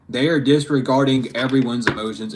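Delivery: ordinary delivery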